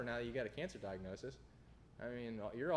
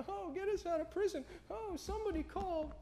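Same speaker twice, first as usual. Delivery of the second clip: much higher, whiny mock plea to be freed; mock crying. Preacher imitates prisoners crying in the stocks, whiny mock plea. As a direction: whimper like a prisoner begging to get out. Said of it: "Most of us would be crying".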